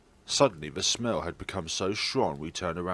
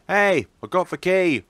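high, loud excited shout; triumphant call; 8 semitones higher, 9 dB louder than usual. Acted. Calling to the classmate across the gap, loud triumphant shout.

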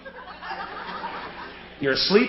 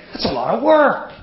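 usual speaking voice

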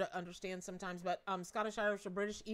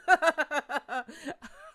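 big high-energy cackling laugh; loud real laughter; 6 semitones higher, 8 dB louder than usual. Real, not acted. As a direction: burst out laughing uncontrollably. Cracks up at a viewer's comment.

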